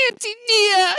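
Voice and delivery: put-on high, loud sobbing child; crying little boy calling his aunt; hiccuping sob. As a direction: choke the name out through a hiccuping cry.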